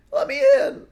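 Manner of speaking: very high, loud scream. Acted. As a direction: scream to be let in. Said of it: "the person outside screamed".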